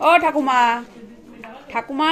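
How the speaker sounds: loud call to a deaf grandma; calling to grandma hard of hearing. Real, not acted. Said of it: "ঠাকুমা আবার একটু কানে কম শোনে".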